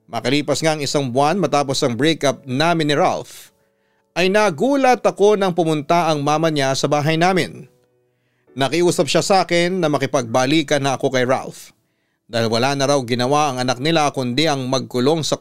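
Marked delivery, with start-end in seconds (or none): none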